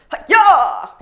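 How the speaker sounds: loud high martial arts kiai; sharp hammed-up karate cry; karate shout. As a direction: yell it like breaking a board. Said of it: "punches, like, the wooden board".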